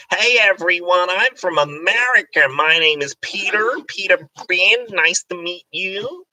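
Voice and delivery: high, loud, squeaky American voice; squeaky American accent. Shown as a demonstration. Host showing his bad American accent, squeakily introducing himself. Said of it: "whenever I do an American accent, it goes into this weird, like, squeaky voice".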